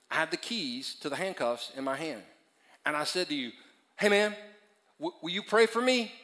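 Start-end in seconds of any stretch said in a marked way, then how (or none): 4.0-6.1 loud and high, rising question; acted urgent request. Pastor in handcuffs asking for prayer, loud and urgent. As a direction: call out for help, hammed-up.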